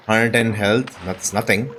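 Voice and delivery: ordinary talk, nothing marked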